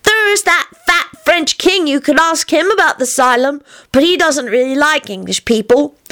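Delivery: put-on high, earnest little-kid suggestion; high-pitched childish chatter; obstinate child Teddy. A narrator's reading. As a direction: reason it out like a small child.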